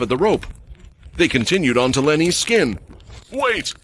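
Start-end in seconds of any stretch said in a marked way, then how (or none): 3.3-3.7 high, panicked yelp; screaming. A narrator's reading. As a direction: cry out in pain. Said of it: "Lenny screamed".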